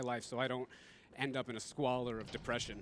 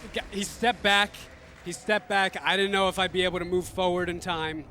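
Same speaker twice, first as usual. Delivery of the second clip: high, loud thrilled yell; excited shout; 6 semitones higher, 11 dB louder than usual. Real, not acted. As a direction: cheer it out. Boss stepped back.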